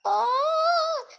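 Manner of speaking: high, crying voice of a flat present; sad flat present. Pretend. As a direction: whimper it like a sad toy. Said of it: "I am gonna be like".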